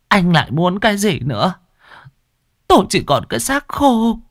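a worn-out mother with nothing left; weary old mother. Acted.

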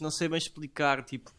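ordinary talk, nothing marked

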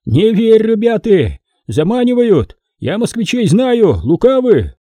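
high-pitched rabble-rousing cry; acted yell, pitched well up; agitated yelling. A narrator's reading. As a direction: warn the crowd urgently. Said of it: "заорал".

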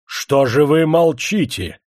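drawn-out irritated yell; angrier shout. A narrator's reading. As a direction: shout at a silent crowd. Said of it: "злобясь пуще, закричал генерал".

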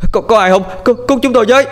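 high, loud desperate calls; panicked boy shouting for help at a door; crying for help. A narrator's reading. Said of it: "miệng không ngừng kêu lên".

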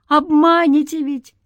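high, distrustful cry; cried out. A narrator's reading. Man doubting the spirit's promise, crying out. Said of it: "крикнул Игнат".

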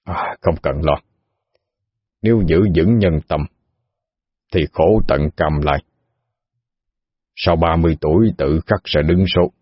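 put-on a calm elderly sage; wise old sage. Old man reassures Mai.

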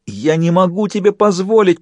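high, strained moan. A narrator's reading. Host trying to recall the puppet, moaning protest. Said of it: "простонал он".

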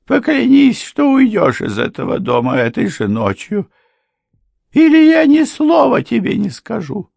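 very high, loud ultimatum; demanding wife. A narrator's reading. Wife orders husband out tonight, high loud demand. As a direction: push it high and forceful.

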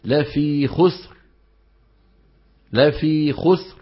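"لا في خسر" with the final ra dropped, ending on sin; swallowed ra. Shown as a demonstration. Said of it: "الغلط". (إسقاط الراء الساكنة)

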